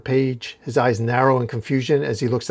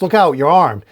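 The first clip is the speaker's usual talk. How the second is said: warning shout, higher and louder; retold yell; 5 semitones higher, 8 dB louder than usual. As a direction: yell a warning to his friend. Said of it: "as Batman yells".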